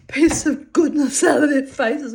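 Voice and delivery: high, loud laugh in the voice. Real, not acted. Mocking gaunt film stars, laughing. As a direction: laugh through the end of the rant. Still laughing at own rant.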